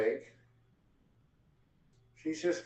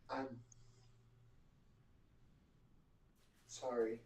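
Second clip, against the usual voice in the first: softer, halting apology; dazed; 5 semitones lower, 15 dB quieter than usual. Acted. Dazed girl answering the friend she thought dead, soft and halting. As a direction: say it softly with a long dazed gap. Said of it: "blank look".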